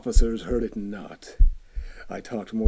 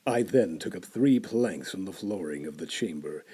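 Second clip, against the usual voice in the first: usual speaking voice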